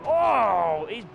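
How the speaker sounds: lively play-by-play commentator voice; upbeat live bike race commentary. Acted. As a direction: call the race like a TV motorsport commentator.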